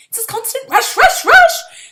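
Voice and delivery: mounting exasperation. Acted. Girl whirled around by her partner, exasperated climbing pitch. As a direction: let the rush words spiral up. Complaining about the rush.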